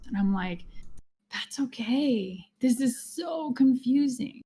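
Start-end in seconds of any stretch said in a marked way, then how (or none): 1.3-4.4 slow, soft and soothing; slow right down and reassure them; slowly soothing a client who feels lost in circles; soft reassurance. Retold or imitated.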